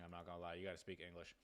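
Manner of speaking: usual speaking voice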